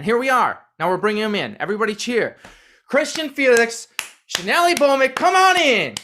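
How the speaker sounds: hype host intro. Acted. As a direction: bring the guests in like a game show host. Hypes the hosts' entrance.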